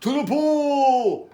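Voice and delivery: drawn-out, high call; long, stretched-out shout of command; firemen's yell. A narrator's reading. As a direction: yell it slowly like a rallying cry. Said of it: "yelled the firemen".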